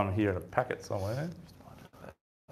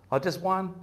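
mock surprise, pitched up high; surprised question; 11 semitones higher than usual. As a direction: tease him for catching only one. Other angler reacts to catch.

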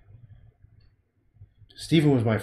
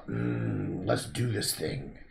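low determined mutter; 4 semitones lower, 6 dB quieter, slower than usual. Pretend. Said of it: "this one is more of a".

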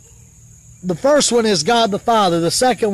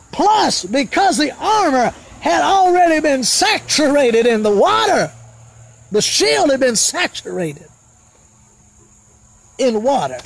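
higher-pitched, ringing declamation with falling phrase ends; fervent preaching; 6 semitones higher than usual. Real, not acted.

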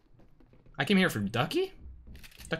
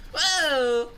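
sudden much higher startled yelp; surprised whoa; 14 semitones higher than usual. Real, not acted. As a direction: caught off guard mid-fight. Sudden action in game.

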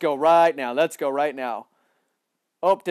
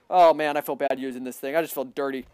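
ordinary talk, nothing marked

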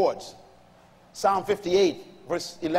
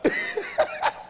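high burst of laughter. Real, not acted. Laughs at his own joke.

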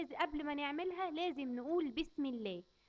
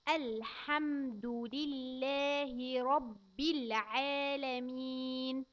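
put-on every syllable drawn out for a child; very slow recitation; much slower than usual. Shown as a demonstration. Teacher reciting very slowly for a child. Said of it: "وناخد معانا تاني آية".

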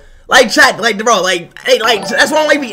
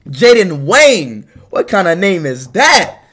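puzzled by a name; 8 semitones lower than usual. Real, not acted. Streamer questions a viewer's odd name, puzzled. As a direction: drop low and stretch the name in doubt.

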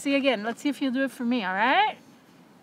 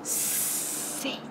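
soft breathy repeated command; whispered sits; 11 semitones lower, 7 dB quieter, much slower than usual. Real, not acted. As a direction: coax him down in a hush. Coaxing a small dog.

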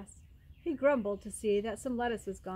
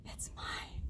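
tiny whisper from underground; faint, breath-only voice; whispered murmur; 8 dB quieter than usual. A narrator's reading. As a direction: whisper it very softly.

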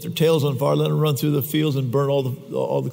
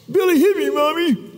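put-on high, loud tearful kid voice; child tattling to mom, high and whiny; 11 semitones higher, 4 dB louder than usual. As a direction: wail it like a hurt five-year-old. Crying child in story.

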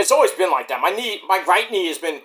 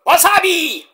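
mock sneeze; sneeze joke; 11 semitones higher, 4 dB louder, slower than usual. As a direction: fake sneeze shouting wasabi.

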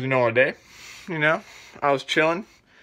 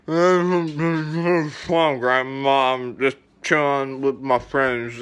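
put-on slow, slurred drunken stalling; mock-wasted drawl to grandma; acting drunk; much slower than usual. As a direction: play drunk, dragging out an innocent answer. Playing himself drunk on the phone.

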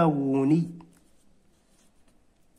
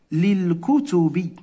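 slow model reading; slower than usual. Shown as a demonstration. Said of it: "إذا شنو غنقولوا؟".

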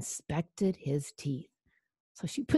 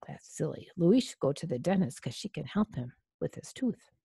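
ordinary delivery, nothing marked

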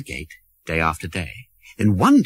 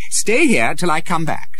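lightly voiced hurried prince; 8 semitones higher, 5 dB louder than usual. Prince leaves Tom.

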